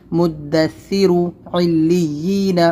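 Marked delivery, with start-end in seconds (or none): 1.5-2.6 علیین drawn out slowly; slow recitation. Shown as a demonstration. Teacher repeating the word slowly for pupils. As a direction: stretch the word evenly. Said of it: "بہت ہی آسان یہ سبق ہے".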